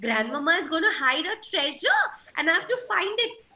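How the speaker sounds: high, squeaky thrilled duckling; duckling thrilled about the treasure; excited. A narrator's reading. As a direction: bounce with excitement over treasure. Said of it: "Ducky is very excited".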